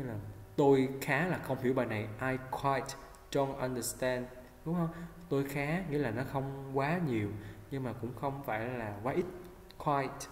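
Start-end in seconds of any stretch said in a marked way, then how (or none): none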